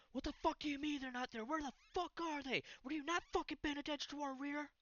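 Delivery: high, strained furious yell in his face. Acted. Soldier yelling at the man he grabs, high and furious. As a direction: scream it up close at the soldier.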